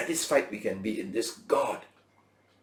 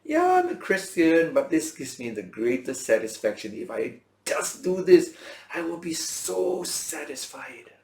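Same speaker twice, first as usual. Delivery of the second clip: imitating complacent Christians; 6 semitones higher, 6 dB louder than usual. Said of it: "many people still think".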